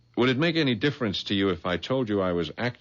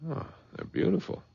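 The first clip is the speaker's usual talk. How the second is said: breathy awe; 10 semitones higher, 4 dB quieter than usual. Acted. Opening the pearl box.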